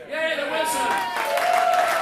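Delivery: rally the room with a whoop; cheering shout. Real, not acted. Host whipping up a cheer for the singers, loud whoop.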